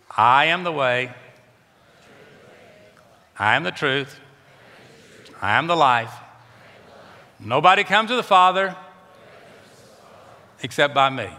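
firm recited line for the church to echo; call and response lead. Shown as a demonstration. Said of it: "Say it with me".

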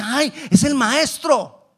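high, loud, drawn-out exclamation; acted joyful realization; excited disciple. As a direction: cry out in sudden recognition. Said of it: "uno de ellos dice".

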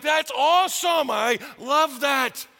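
mock delight at a spouse's change. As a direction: gush with pleasure. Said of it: "ones you're like".

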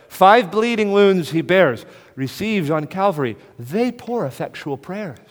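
loud, high, slow proclamation; full-force preaching. Acted. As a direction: hammer each word out slowly, counting the wounds. Preaching the hymn again.

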